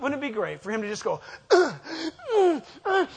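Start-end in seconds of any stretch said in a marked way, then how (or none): 1.5-3.1 strained grunting, pitched up and louder; mock straining grunts. Preacher mocking Satan straining, strained grunts. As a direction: grunt like you're pushing hard.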